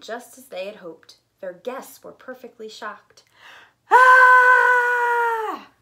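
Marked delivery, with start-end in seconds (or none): 3.9-5.7 loud high scream; terrified scream. Acted. Shocked guests screaming at the animals. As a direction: shriek in fright.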